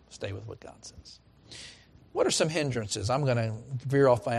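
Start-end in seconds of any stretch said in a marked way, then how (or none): 0.1-1.2 soft echo. Acted. Preacher echoes his own line.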